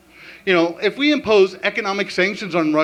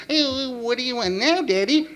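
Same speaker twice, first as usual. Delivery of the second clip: higher, childlike cartoon bear; cartoon Boo Boo voice; 6 semitones higher than usual. Kid to dad in a high cartoon voice. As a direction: squeak it like a cartoon cub. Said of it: "talk to each other in cartoon voices".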